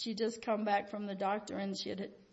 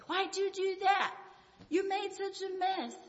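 mock exasperated parent, pitched up and stretched; railing; 8 semitones higher than usual. Parent railing at a child over spilt milk, mock scolding. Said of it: "rail on them and just say".

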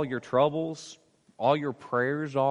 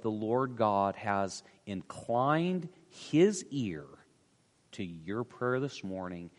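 soft closing blessing; 6 semitones lower, 6 dB quieter than usual. Real, not acted. Pastor closing the sermon with a tender, fading blessing. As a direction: bless them gently as you close.

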